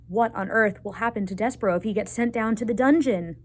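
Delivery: ordinary delivery, nothing marked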